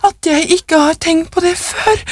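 put-on high, frantic old wife; hysterical. A narrator's reading. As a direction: exclaim frantically, pitch soaring. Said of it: "sa hun hysterisk".

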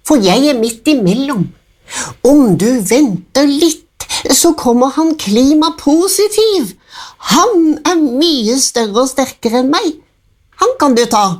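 the middle goat sends the troll to his big brother. A narrator's reading. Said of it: "sa Klimanøytral".

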